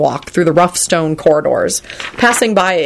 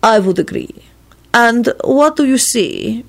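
slow, deliberate character voice; 6 semitones higher than usual. A narrator's reading. Radu quizzing his guest on the poet, deliberately. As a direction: draw out the question, pitched higher. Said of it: "continued in his deliberate manner".

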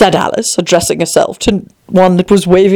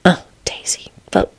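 hushed, unvoiced whisper; whispered aside; 3 semitones higher, 6 dB quieter than usual. Readers hastily casting the daisy, whispered. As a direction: whisper it behind the scenes.

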